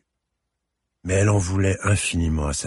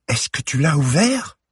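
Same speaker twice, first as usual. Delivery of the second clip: high, sharp disbelieving question; shocked accusation; 9 semitones higher, 4 dB louder than usual. Acted. Girl to her mother, high and shocked. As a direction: she can't believe her mother read it.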